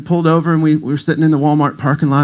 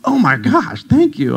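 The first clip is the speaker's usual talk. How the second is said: gushing gratitude; 10 semitones higher, slower than usual. Pretend.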